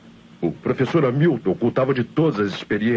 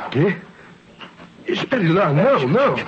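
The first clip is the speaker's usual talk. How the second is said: alarmed protest; 7 semitones higher than usual. Acted. Dodó drags him to the shed.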